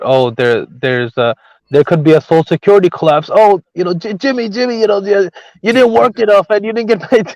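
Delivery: put-on high whiny old man; grumbling out of touch boomer. Mocking old man complaining.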